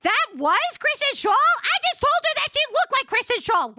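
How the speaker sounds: high, loud horrified realization; shocked disbelief. Retold or imitated. Worker realizing her blunder, high shocked cry. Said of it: "I was like".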